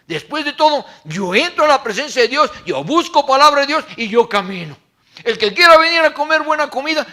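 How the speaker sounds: loud, high impassioned sermon; fiery pulpit delivery; full-force preaching. Acted. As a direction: preach it out to the whole church.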